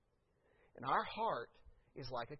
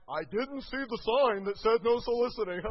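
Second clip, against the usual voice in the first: louder, higher mock innocent salesman; playing stupid; 5 semitones higher, 8 dB louder than usual. Salesman walking past the no soliciting sign, mock innocent. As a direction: act like you never saw the sign. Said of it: "you have to play stupid".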